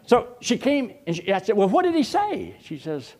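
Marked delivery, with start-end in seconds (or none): 1.6-2.5 high, loud incredulous questions; can't believe he missed it, pitched high and loud; baffled husbandly protest. Retold or imitated. Husband to wife, high and loud in disbelief.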